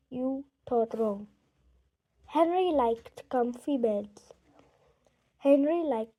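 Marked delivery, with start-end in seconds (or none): none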